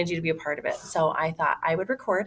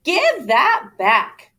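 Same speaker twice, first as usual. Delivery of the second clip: slow, higher, louder demand; indignant exclaim; 8 semitones higher, 6 dB louder, much slower than usual. Acted. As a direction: drag out the plea to get it back. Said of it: "Noel exclaimed".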